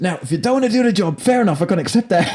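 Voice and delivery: winding himself up, half laughing; getting worked up. Retold or imitated. Don gets worked up at Gal. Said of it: "he's getting worked up".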